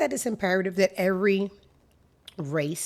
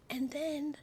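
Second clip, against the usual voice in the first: whisper; 8 semitones higher, 16 dB quieter than usual. Real, not acted. Whispering so her son in the next room can't hear. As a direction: keep it to a whisper, someone's nearby. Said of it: "I have to whisper".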